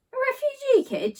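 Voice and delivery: high-pitched, startled echo of the words; puzzled question. Acted. Mum hearing a new phrase from her child, startled and high. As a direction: echo it back in disbelief. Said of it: "she asked with a frown".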